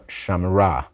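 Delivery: shamra run together, the shva silent; one-syllable shamra. Shown as a demonstration. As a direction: shamra said as two quick syllables. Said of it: "you're liable to hear me pronounce it as two syllables".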